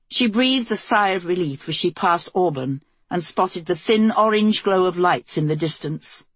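usual speaking voice

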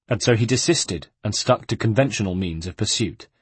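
usual speaking voice

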